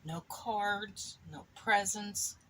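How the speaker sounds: ordinary delivery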